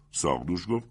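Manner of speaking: ordinary delivery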